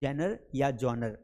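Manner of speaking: genre said slowly, then जॉनर said "jonar"; slow correct genre. Shown as a demonstration. Teacher reveals the proper pronunciation of genre: slow. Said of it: "that is called".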